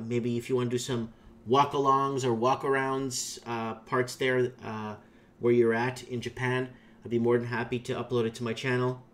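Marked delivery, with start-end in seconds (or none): none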